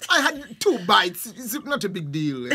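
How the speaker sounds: laughing it off; giggling through the words, slowing down. Real, not acted. A friend laughing off mosquito bites, giggling.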